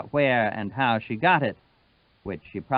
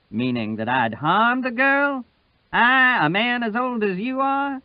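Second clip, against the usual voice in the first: much higher, louder outraged retort; indignant Sutphen; 11 semitones higher, 5 dB louder than usual. Acted. Sutphen rearing back at Wash, higher and louder. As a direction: fling the question back.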